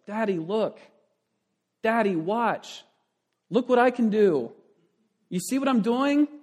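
a small daughter shouting from the swing for attention; little girl yelling. Retold or imitated. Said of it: "she continually yells".

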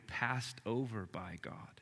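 softly whispered appeal; gentle whispered sermon line. Acted. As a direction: hush it like a secret hurt. Quiet pastoral appeal.

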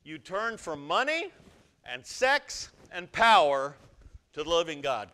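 loud, slow, stretched out; loud, slow emphasis. Shown as a demonstration. Pastor hammering the verse, loud and slow. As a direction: hammer money, sex and power. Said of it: "replace the word idol with money".

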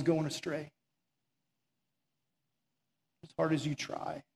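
soft, low preaching invitation; a low hushed altar call; quiet altar call. Acted. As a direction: draw them in gently to surrender.

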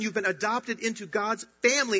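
ordinary talk

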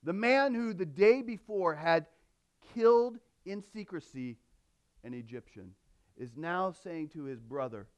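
ordinary talk

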